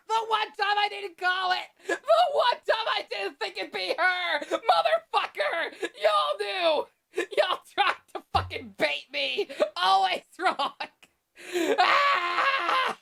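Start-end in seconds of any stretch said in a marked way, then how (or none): 0.1-9.5 high wailing shout, half laughing; outraged shout. Real, not acted. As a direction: yell in real outrage. Shock at game reveal.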